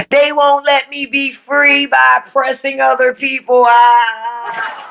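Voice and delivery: high mocking whine of a crybaby; mock them as crybabies, high-pitched; whiny conservative. Mocking conservatives to his viewers, high whiny voice. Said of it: "most crying ass fucking people".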